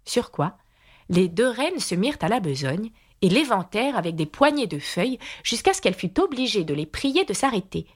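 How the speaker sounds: ordinary delivery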